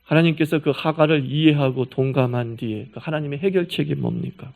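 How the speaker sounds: ordinary delivery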